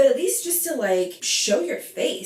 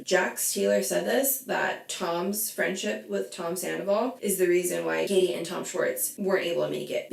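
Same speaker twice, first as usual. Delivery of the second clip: normal talking voice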